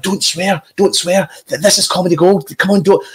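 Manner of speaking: imitating anxious colleagues; urgent coaxing. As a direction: egg him on urgently. Colleagues urgently coach him.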